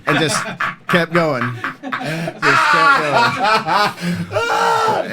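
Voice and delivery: can't get it out for laughing. Real, not acted. Telling his teammate the coach's vulgar joke, laughing.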